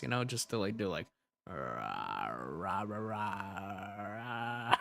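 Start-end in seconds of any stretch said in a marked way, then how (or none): 1.5-4.8 very low, soft rah growls; low growl attempt. Shown as a demonstration. Streamer trying a growl for chat, very low and soft. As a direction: try to growl deep from the chest.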